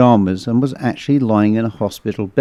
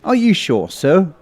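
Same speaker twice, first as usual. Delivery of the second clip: higher-pitched doubting question; inquisitive; 7 semitones higher than usual. Acted. Constable doubting the patient's story, inquisitive. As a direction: check politely but doubtfully, higher. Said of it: "looked at me inquisitively and said".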